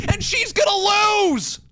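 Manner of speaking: venting that it always happens; fed-up fan yelling at the booking; frustrated rant. Real, not acted. Ranting about babyfaces losing.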